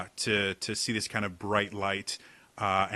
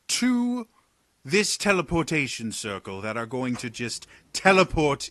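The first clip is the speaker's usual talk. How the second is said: high, loud, theatrical exasperated sigh; a wry noble teasing the jumpy guards; Talon's voice; 4 semitones higher, 5 dB louder than usual. Acted. Talon scolds the guards for their glaives, mock weary.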